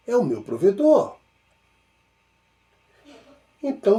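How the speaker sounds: ordinary talk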